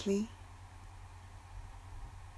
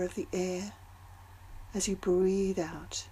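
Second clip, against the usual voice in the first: guided meditation. Acted. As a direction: ease them into calm with long pauses. Leads a breathing meditation.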